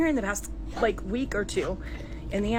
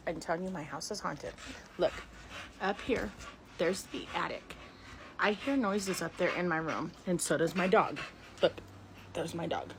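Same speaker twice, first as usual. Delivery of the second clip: softer, hushed voice; hushed tour; 3 semitones lower, 9 dB quieter than usual. Real, not acted. Showing viewers the attic, hushed. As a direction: keep it down near the attic.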